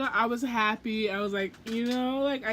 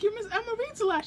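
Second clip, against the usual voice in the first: much higher, fast, eager; excited gush; 10 semitones higher, faster than usual. Retold or imitated. Fan greeting Emma, high fast eager gush. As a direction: rush it out in excitement.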